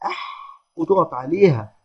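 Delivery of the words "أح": soft, airy أح held on the ح; whispery "aḥḥ" like touching something hot; burnt-finger "aḥ". Shown as a demonstration. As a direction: breathe out أح as if scalded. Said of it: "لما بتتلسع بحاجة سخنة تقول إيه".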